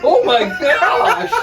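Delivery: high, slow, loud gasping exclamation; shocked. Real, not acted. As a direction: react to a surprise bruise. Sees a bruise, shocked.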